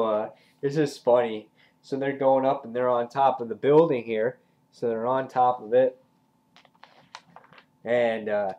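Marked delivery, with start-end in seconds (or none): none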